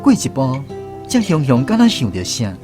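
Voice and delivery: ordinary talk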